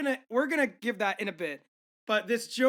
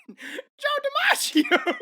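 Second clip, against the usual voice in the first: very high, loud, drawn-out howl; talking dog; 9 dB louder, much slower than usual. Retold or imitated. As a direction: howl the punchline as a dog, stretched out. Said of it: "Dog, who's the best baseball player".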